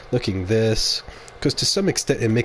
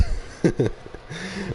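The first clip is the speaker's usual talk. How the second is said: giggle; 9 semitones higher, 5 dB quieter than usual. Real, not acted. Laughs at own joke.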